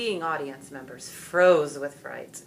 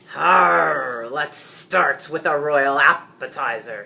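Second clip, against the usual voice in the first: a loud harr then a slow airy cackle; cackling dragon; 11 dB louder, slower than usual. A narrator's reading. Dragon peers at the king, cackling wickedly. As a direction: gloat like a villain about to feast. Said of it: "he cackled".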